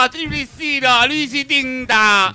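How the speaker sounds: high, drawn-out promo voice; announcer showing off. Shown as a demonstration. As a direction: prove you're an announcer, slowly and high. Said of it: "Soy locutor".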